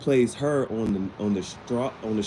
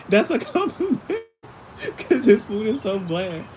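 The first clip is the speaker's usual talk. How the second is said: talking through high laughter; real laugh running through the words; 11 semitones higher, 3 dB louder than usual. Real, not acted. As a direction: squeeze the words out while laughing. Laughing at own food joke.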